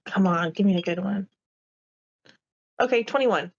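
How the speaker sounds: ordinary delivery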